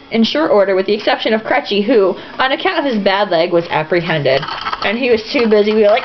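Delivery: usual speaking voice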